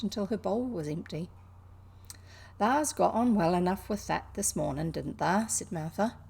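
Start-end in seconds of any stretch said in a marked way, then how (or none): 2.6-5.5 lightly voiced Yorkshire maid; a hint of broad Yorkshire; mild Yorkshire lilt; Yorkshire accent. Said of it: "apologies for the accent".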